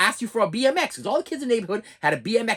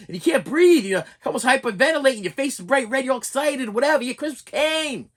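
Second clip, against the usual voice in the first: ordinary talk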